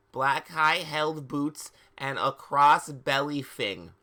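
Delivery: ordinary talk, nothing marked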